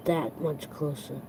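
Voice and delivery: soft, fading, moved voice; choked up. Real, not acted. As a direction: near tears, words trailing away quietly. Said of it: "if you can see it in my face".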